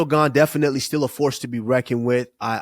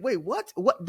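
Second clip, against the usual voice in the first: mock confusion; 8 semitones higher, 5 dB quieter than usual. Retold confusion.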